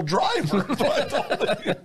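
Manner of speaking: amused self-mocking confession; laughing. Real, not acted.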